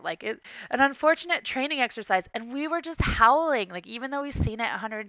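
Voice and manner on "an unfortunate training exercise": lightly acted, higher-pitched movie line; a touch of mock-official delivery; Terrence Howard quote. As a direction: quote the film line with relish. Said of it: "quoted it as Terrence Howard said it".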